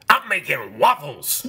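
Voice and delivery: slow, drawn-out Donkey impression. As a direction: do Eddie Murphy's Donkey. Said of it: "Eddie Murphy and Donkey".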